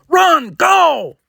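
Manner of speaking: high, loud yell. Acted. Kirk yelling at the heroes to run. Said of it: "Kirk yells".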